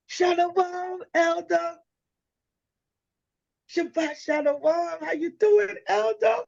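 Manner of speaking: very high, sugary drawn-out greeting; sweet mock greeting. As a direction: act all holy and nice. Sweet greeting to elder retold.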